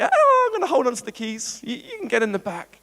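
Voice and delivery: high-pitched hedging, easing off at the end; mock reluctant bargaining. People telling God to get in the back, mock reluctant. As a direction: play someone clinging on to control.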